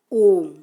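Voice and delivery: ओम held very long and loud, a drawn-out chant; prolonged om. Shown as a demonstration. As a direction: sustain ओम for triple length. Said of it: "ओम ये आपका प्लुत स्वर है". (ओम को तिगुना लंबा खींचकर)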